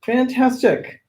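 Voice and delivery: high, delighted exclamation; light up at the news; excited. Real, not acted. Reacting to second Samantha joining.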